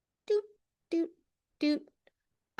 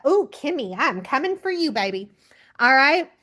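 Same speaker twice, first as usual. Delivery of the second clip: ordinary talk, nothing marked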